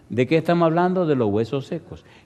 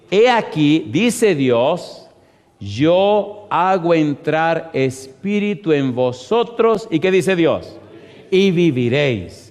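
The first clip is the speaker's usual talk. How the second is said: loud, drawn-out scripture proclamation; preaching at full force; 5 semitones higher, 5 dB louder, slower than usual. Real, not acted. Preacher declares God's promise to congregation, proclaiming loudly. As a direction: proclaim it with rising, insistent force.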